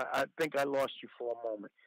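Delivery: ordinary talk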